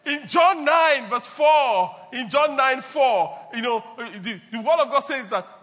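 loud, slow, emphatic sermon delivery; preaching. Acted. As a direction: proclaim it to the congregation. Preaching the urgency message.

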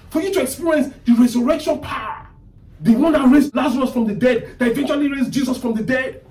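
high-pitched, driving sermon delivery; impassioned preaching. Real, not acted. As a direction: build with fervor to a forceful proclamation. Preaches at full force.

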